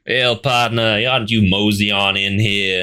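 a cowboy greeting in a hammed-up Texan accent; heavy Texan. Podcaster imitating Blofeld's disguise voice, lightly put-on Texan accent. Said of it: "like, a heavy Texan kind of".

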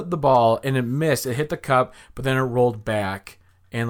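ordinary delivery

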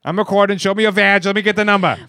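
high, loud bossy demands; pretending to confront the rude airline agent; mock confrontation. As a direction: play the angry customer filming.

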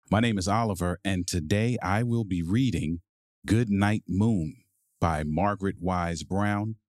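plain reading voice

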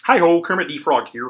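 high Kermit puppet voice; Kermit the Frog voice. Pretend. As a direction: do the frog's bright hi-ho greeting. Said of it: "Can you do your Kermit the Frog".

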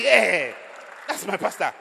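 loud, high proud exclamation; showing off his pastor's picture, loudly proud; proud boast. Retold or imitated. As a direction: beam with pride about your pastor.

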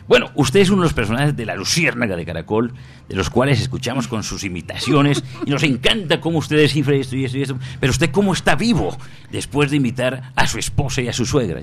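imitating Darío Arizmendi. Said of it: "la pregunta que me hace Darío Arizmendi".

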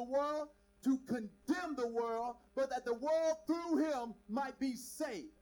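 loud, driving sermon voice; full-force preacher; preaching. Acted. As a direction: preach it out to the crowd.

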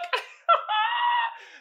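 high screaming burst of laughter; shrieking laugh. Real, not acted. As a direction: howl with laughter. Cracking up.

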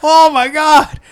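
high, loud, hammed-up rapture; mock ecstasy. Customer overwhelmed by the madam's trick, in mock ecstasy. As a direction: exclaim as if overcome with pleasure.

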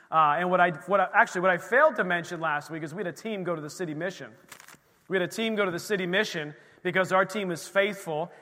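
loud, fervent sermon voice; preaching. Acted. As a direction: preach it out to the room. Testimony preached with force.

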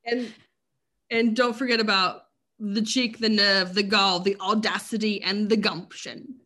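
loud mock Welsh accent. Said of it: "my Welsh accent".

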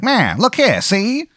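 put-on high-pitched old-time private eye voice; 1920s detective. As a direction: introduce yourself like an old movie detective. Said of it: "like a nineteen twenties style detective".

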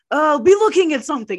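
loud, high, fast command; mock director's urgent shout; quick yelled direction. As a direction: yell a hurried cue to an actor. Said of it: "the director really quickly yelled".

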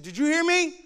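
sudden high demand from an ignored mom; scolding mother. Acted. Mother to son who won't talk to her, mock scolding. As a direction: imitate mom demanding an answer.

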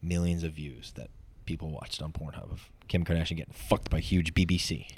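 low, hushed murmur; near whisper. Real, not acted. Joking to a co-host close on the mic, near whisper. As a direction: drop it low and soft into the mic. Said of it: "Why are you whispering?".

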